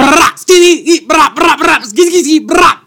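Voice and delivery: high, loud shrieking raptor calls; raptor screech. Pretend. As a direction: screech like a velociraptor. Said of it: "exactly what Blue sounded like".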